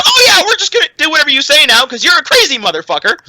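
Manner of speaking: fast, high mock orc chorus. Mocking the cowed orcs to a friend, fast and high. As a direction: rattle it off like dim obedient orcs. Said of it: "all the rest of the orcs go".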